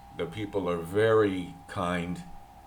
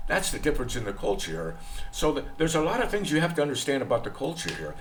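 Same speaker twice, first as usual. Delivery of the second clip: ordinary talk, nothing marked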